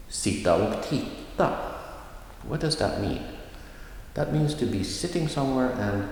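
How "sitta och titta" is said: sitta och titta said again, stress on titta; phrase stress. Shown as a demonstration. Teacher repeating the example for learners. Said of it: "One more time".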